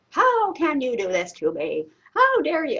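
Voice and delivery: loud retold yell at God; yelling prayer. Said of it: "sounded more like a yelling fest".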